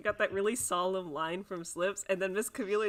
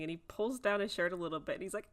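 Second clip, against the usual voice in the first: ordinary delivery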